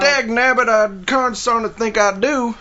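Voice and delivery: put-on high, slow old prospector. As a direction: play a cranky old-timer from the Old West. Answers as an old western character.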